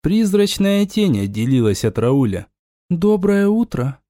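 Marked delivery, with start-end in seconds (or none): 2.9-4.0 bright voice inside his head; high ghostly greeting; whispery telepathic voice. Acted. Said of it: "Голос прозвучал в сознании".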